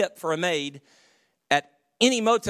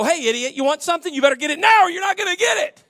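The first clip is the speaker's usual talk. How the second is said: brusque, louder mock stewardess; a mock-rude stewardess snapping at coach; rude attendant; 10 semitones higher, 7 dB louder, faster than usual. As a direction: bark it at a coach passenger.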